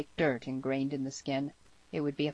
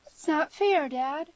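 put-on high, loud girlish protest; high-pitched outburst to Dad; exclaimed; 12 semitones higher, 5 dB louder, slower than usual. A narrator's reading. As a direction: exclaim it as an indignant daughter. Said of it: "exclaimed the girl".

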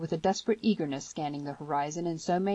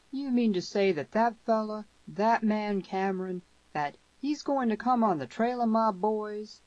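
higher, slow, drawn-out questioning; incredulous rancher father; 5 semitones higher than usual. A narrator's reading. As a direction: question it slowly as a disbelieving father.

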